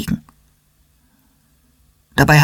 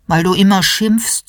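plain reading voice

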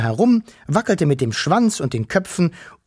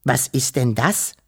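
plain reading voice

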